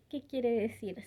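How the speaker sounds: normal talking voice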